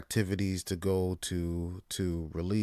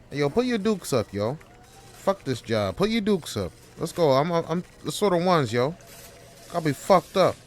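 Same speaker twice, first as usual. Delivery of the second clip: fight challenge; 8 semitones higher, 5 dB louder than usual. Acted. Employee challenging boss to fight.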